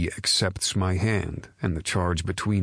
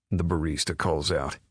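usual speaking voice

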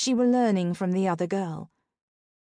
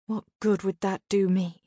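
usual speaking voice

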